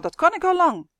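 high-pitched, loud protest; boy yelling. A narrator's reading. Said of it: "gilde de jongen". (hoog en hard gillen als een jongen)

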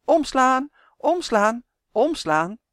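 loud, high, insistent commands; repeated calling out. A narrator's reading. Malien keeps ordering the book to turn, calling loudly. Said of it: "riep voortdurend".

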